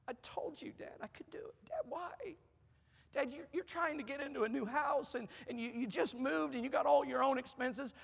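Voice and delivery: high, tearful pleading as daughter; imitating a daughter sobbing on the phone, voice rising and breaking; retold crying. Daughter calls dad in tears after bill paid, high crying voice. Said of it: "She's crying".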